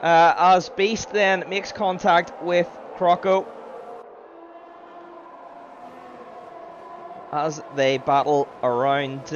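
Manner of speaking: slow, loud play-by-play; motorsport commentator. Acted. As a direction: call the battle deliberately. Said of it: "I will do my best to commentate".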